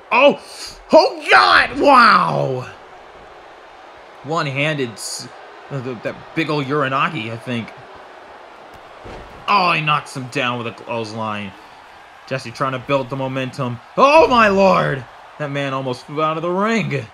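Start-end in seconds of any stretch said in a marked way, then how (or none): 0.1-2.7 very loud, high burst of amazement; amazed shout. Real, not acted. Stunned reaction to big move. 14.0-15.0 loud, high, drawn-out cry; stunned ringside reaction; shocked exclamation. Real, not acted. Shocked reaction to near fall-out.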